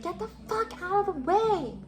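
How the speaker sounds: mock yell, stretching out the last word; retold biker's yell. Biker yelling at a pedestrian in the bike lane, imitated yell. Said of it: "I just get yelled at in public".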